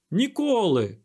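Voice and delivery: high, loud refusal; drawn-out defiant shout; yell. A narrator's reading. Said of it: "заволав дикун".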